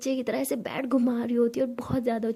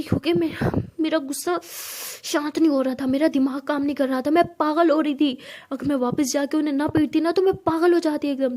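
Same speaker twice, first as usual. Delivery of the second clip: louder, building, fired-up; heated rant; 3 semitones higher, 5 dB louder than usual. Acted. Siya telling Tara why she beat them up. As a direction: let the rage spill out. Siya explaining her rage.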